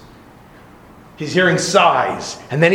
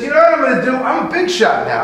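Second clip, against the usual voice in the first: boastful villager; 9 semitones higher, faster than usual. Acted.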